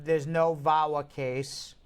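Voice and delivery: usual speaking voice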